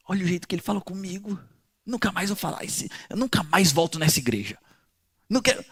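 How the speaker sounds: resmungo bem baixinho de quem se ofendeu; ressentimento imitado. Retold or imitated. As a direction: reclame baixinho, fingindo mágoa. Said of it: "Ana não fica assim". (imitação de ofendida, resmungando baixo)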